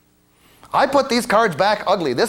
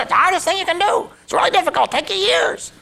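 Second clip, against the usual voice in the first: much higher imitation of the old professor; imitating the professor; 12 semitones higher than usual. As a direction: quote the professor in a high put-on voice. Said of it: "That's exactly how he said".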